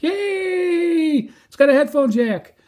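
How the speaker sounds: high-pitched delighted yay, then gushing; excited squeal. Real, not acted. Reviewer cheering that the radio has a headphone jack, thrilled high squeal. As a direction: squeal with real joy over the feature.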